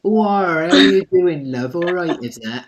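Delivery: imitating a Devon local greeting someone; Devon accent. Interviewer doing a playful Devon accent for the guest. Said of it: "we all think people in Devon speak like this".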